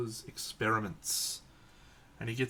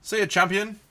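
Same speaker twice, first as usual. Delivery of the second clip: much higher and louder send-off; loud mock goodbye; 10 semitones higher, 11 dB louder than usual. Shouting goodbye to a mate in mock Aussie. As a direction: bellow it like a cheery Aussie.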